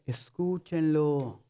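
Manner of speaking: low, slow, drawn-out command; God answering. Acted. God answers the protest, low slow patient command. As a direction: sink low and stretch it out calmly. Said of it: "Y Dios dice".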